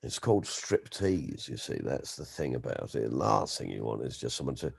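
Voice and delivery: hint of a hushed, appalled aesthete; Peter Cook impression. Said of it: "Peter Cook just went".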